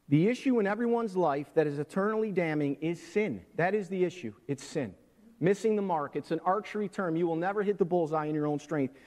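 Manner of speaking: loud pulpit preaching; full-voiced sermon; preaching at full force. Real, not acted. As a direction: drive the message home to the room.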